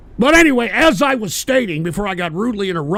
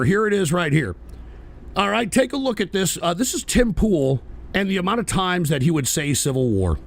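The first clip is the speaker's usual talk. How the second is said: usual speaking voice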